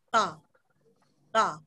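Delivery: TA with tongue tip touching upper front teeth; short low TA, between d and t; dental Korean d. Shown as a demonstration. After explaining tongue placement, dental TA. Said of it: "nempel ke gigi depan atas".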